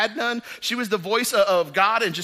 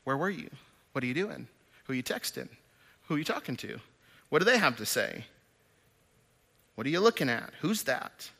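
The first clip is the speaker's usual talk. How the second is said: mock suspicious quizzing; 5 semitones lower, 6 dB quieter, faster than usual. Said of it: "constantly checking up on you".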